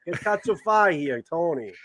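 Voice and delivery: playing a Jersey mob guy, Italian-American New York accent; New York mobster accent. Sopranos-style mobster bit.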